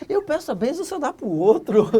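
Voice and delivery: high, loud outraged cry at God; indignant outburst. Retold or imitated. Complaining to God about the blessing going elsewhere, outraged. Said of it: "Eu fiquei indignado".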